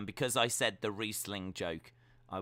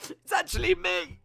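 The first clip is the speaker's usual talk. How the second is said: high, louder, mock sobbing; mock tearful confession; 7 dB louder than usual. Streamer confessing he is the fat dog, mock sobbing. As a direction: confess it with a fake sob.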